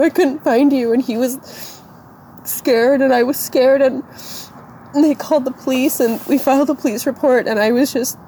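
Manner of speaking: real upset, voice still pitched high; tearful. Real, not acted. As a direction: keep telling it while fighting back tears. Still tearful telling the aftermath.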